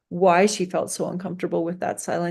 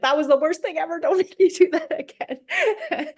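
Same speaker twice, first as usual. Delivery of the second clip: much higher, laughing mock whine of a kid; whiny kid; 10 semitones higher than usual. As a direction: play the kid who hated it, whining through a laugh. Polar opposite kid quoted.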